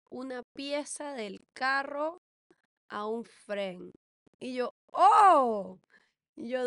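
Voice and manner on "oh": "oh" muy fuerte y alargado; asombro exagerado. Retold or imitated. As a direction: suelta un grito de falso asombro. Reacción actuada a la excusa. (exclamación teatral a gritos)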